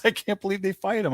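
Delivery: high-pitched retold incredulity; pissed-off disbelief. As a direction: imitate an upset friend who can't believe it. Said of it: "I'm pissed off".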